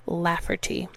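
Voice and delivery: usual speaking voice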